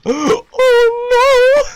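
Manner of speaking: very high, loud panicked yelp; real panicked cry. Real, not acted. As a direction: cry out in fright. Scared in game.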